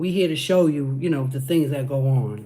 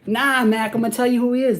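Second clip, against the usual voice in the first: ordinary talk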